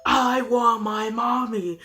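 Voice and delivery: slow, eerie demonic echo; lightly done slow demonic voice; mock demonic voice. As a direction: drag it out like a demon mimicking the child. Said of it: "we heard the demonic voice again".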